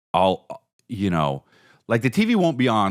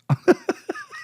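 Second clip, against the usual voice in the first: high squeal of laughter. Real, not acted. Laughing at James Bond.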